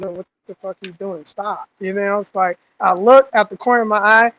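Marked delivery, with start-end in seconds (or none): 0.0-1.7 quick, low, soft scolding; urgent aside kept under the breath; hushed warning. Retold or imitated. Recruit urging his friend to stop looking around, hushed warning.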